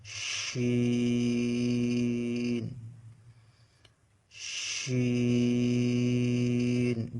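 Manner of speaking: শিন held long with a steady sh sound; drawn-out shin. Shown as a demonstration. Teacher showing students the letter শিন, drawn out. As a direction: stretch শিন slowly. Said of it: "আমার সাথে সাথে বলে".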